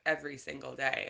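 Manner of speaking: ordinary talk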